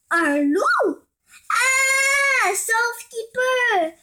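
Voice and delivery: high, loud panicked cry, dragging the last words; terrified shriek. Acted. Savanna animals fleeing the wolf, shrieking in terror. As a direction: scream and scatter in fright. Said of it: "hurlèrent les animaux terrorisés".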